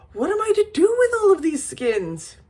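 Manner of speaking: high-pitched exasperated question; exasperated protest. A narrator's reading. Overwhelmed skin buyer's quoted protest.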